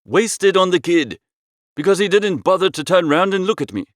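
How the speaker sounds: usual speaking voice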